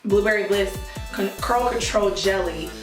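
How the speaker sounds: normal talking voice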